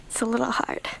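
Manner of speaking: tearful whisper breaking into a high, cracked "sorry"; fighting back tears while trying to speak; choked up. Real, not acted. Daughter remembering her lost sheep, whispering through tears. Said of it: "It's still really emotional for me".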